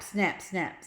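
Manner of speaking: ordinary delivery, nothing marked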